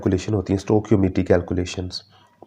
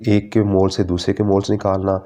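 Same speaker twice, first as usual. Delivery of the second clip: ordinary talk, nothing marked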